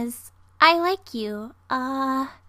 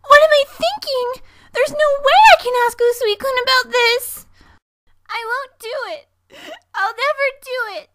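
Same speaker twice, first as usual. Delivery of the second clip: much higher, frantic schoolgirl panic; panicked outburst; 9 semitones higher, 5 dB louder than usual. Acted. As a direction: spiral into denial, voice high.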